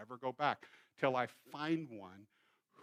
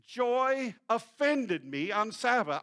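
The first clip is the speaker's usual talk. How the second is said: higher, slow, loud complaint; upset caller; 8 semitones higher, 8 dB louder, slower than usual. Retold or imitated. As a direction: drag out the grievance.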